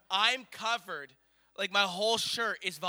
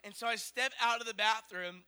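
ordinary talk

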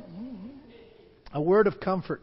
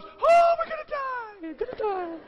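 mock panic. Believers facing a trial, wailing in mock panic.

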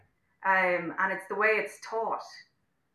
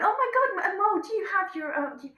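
mock-regal girl, pitched way up; posh royal airs; 10 semitones higher than usual. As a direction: put on a high, la-di-da near-royal voice. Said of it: "had all the airs and graces of, uh, almost like royalty".

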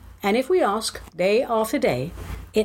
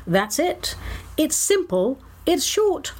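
ordinary talk

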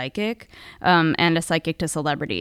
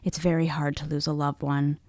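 softer, lower, consoling; soothing voice; 5 semitones lower, 5 dB quieter than usual. Retold or imitated. Psychic consoling her client about a parent, soothing. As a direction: hush it gently as if comforting. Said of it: "said in a soothing voice".

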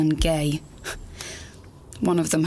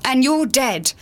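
loud, high, wounded shouting at her mother; anguished outburst; 8 semitones higher, 5 dB louder than usual. Acted. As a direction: hurl it at her, heartbroken and furious. Angry outburst at dead mother.

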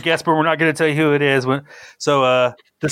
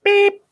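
high-pitched vocal beep; short, piercing mock bleep; imitated beep; 4 dB louder than usual. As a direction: beep like an editing tone. Imitated censor beep to cut take.

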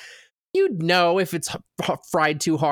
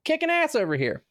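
very high, elated boasting; hammed-up, pitched-up self-congratulation; mock triumph; 13 semitones higher than usual. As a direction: celebrate as the patient on a winning streak. Retold patient celebrating good results.

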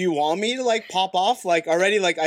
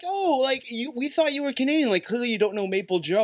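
mock locals; 5 semitones higher than usual. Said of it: "They'd be like".

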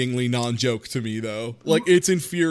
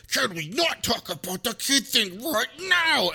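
higher, slow, put-on voice; mock annoyed husband; 9 semitones higher, slower than usual. Mocking the husband's reply to his wife, slow and high. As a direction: drag it out, hammed-up irritation. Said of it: "he feels the need to be like".

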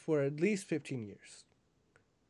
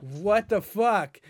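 stunned slow; 4 semitones higher, 5 dB louder, much slower than usual. Retold or imitated. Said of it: "And it's like".

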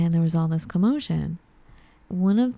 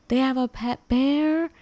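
retold yell; 7 semitones higher than usual. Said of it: "I'm, like, yelling".

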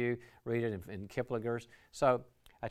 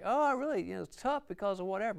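much higher, plaintive role-play employee; struggling team member; 9 semitones higher than usual. Acted. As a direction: act out a stressed worker pouring out trouble.

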